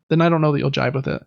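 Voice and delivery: ordinary delivery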